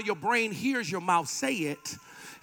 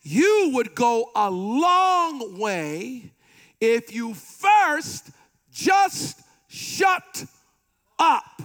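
building punchline; 3 dB louder, much slower than usual. Acted. Preacher teasing the crowd, building to a loud shut up. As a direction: stretch it out, then bark shut up.

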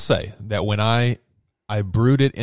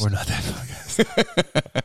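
mock whisper; 3 semitones higher, 3 dB quieter, much slower than usual. Echoes partner in mock whisper.

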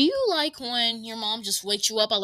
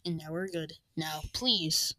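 ordinary delivery

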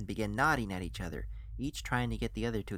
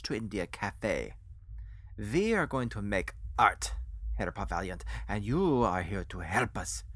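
put-on accented foreign financier, welcoming. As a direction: draw out each phrase in a heavy accent. Voices Rolf, the foreign financier.

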